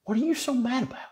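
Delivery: put-on high, puzzled kid voice; little David asks big brother, high and innocent. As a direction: look up at him, baffled. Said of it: "looking up at big brother like".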